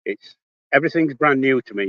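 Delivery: ordinary talk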